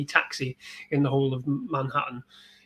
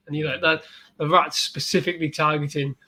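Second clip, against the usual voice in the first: ordinary talk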